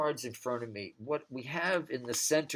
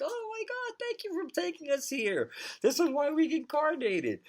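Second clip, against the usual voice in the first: much higher, gushing with ecstatic thanks; ecstatic souls. Retold or imitated. Souls thanking God for bringing them here, much higher and ecstatic. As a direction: squeal it out like a soul overjoyed. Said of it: "it's when our souls are ecstatic".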